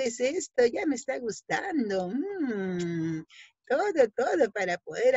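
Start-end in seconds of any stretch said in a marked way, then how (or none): none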